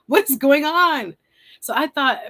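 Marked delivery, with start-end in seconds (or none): 0.1-1.2 mock confusion. Retold confusion.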